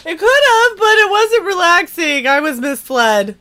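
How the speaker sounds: high, loud indignant protest; loud and outraged. Real, not acted. Indignant protest, much louder.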